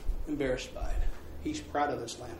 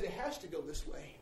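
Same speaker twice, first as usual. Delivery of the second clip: quiet firm father; 4 semitones lower, 17 dB quieter than usual. Retold or imitated. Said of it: "He says".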